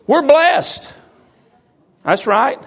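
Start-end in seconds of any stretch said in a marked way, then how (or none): 0.1-0.9 higher-pitched imitation of Brian's regional accent; Brian's vernacular. Pastor mimicking an absent member's testimony, in Brian's accent. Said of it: "get it in Brian's vernacular".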